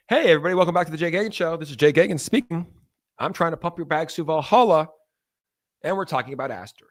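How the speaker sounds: usual speaking voice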